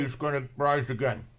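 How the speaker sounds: words muffled by a hand over the mouth; muffled behind hand. Shown as a demonstration. Pastor muffles Jesus' words Peter missed, hand over mouth. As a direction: say it through your covering hand. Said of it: "That's why I covered my mouth".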